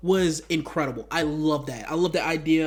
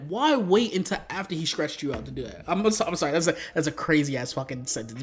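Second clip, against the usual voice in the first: ordinary talk, nothing marked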